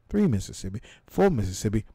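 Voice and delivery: ordinary talk, nothing marked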